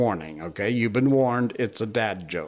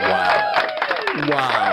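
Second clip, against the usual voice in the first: a very high, louder gasp of awe; amazed wow; 5 dB louder than usual. Real, not acted. Host moved by a viewer's poem, amazed wow. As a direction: let the wow burst out in wonder.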